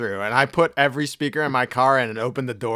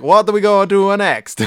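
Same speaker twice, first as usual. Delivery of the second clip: higher, louder mock foreign accent; Borat-ish accent; 8 semitones higher, 9 dB louder than usual. Tourist talking to Italian woman, Borat-like accent. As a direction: ham it up like Borat. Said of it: "starts sounding like Borat".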